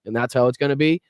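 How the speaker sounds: ordinary talk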